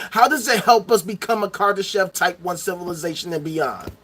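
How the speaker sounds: normal talking voice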